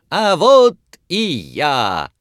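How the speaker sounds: high, slow showman's cry; loud shout. A narrator's reading. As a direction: call out to the crowd again. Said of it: "громко крикнул хозяин". (громкий выкрик)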